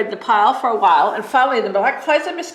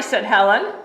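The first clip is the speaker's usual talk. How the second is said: plain reading voice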